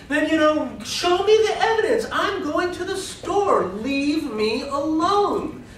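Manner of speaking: high, insistent protest with a wry laugh; indignant defiance. Retold or imitated. Fatima demands the court show evidence, high and defiant. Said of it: "And she's sitting like".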